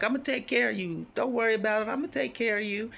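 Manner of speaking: imitating a confident doctor; reassuring doctor. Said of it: "he was like".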